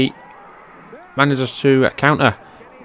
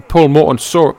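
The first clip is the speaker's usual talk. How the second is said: ordinary delivery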